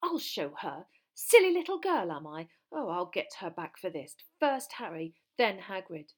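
loud bursts of indignant anger; raging. A narrator's reading. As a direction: vow revenge in a rage. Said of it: "She was shaking with rage".

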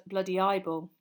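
ordinary delivery

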